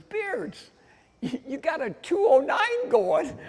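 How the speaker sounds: high-pitched, excited retelling; amazed announcement. Retold or imitated. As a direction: act out astonishment at the split time. Acted amazement at halfway time.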